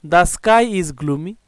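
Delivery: very slow, deliberate English sentence; teacher dictating an example sentence. Shown as a demonstration. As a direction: read the example word by word. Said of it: "example sentence read".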